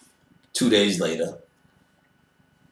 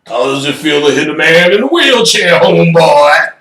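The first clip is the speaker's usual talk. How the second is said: loud, higher mocking voice; taunting wheelchair man; 6 semitones higher, 9 dB louder than usual. Man in the wheelchair taunting him, loud and mocking.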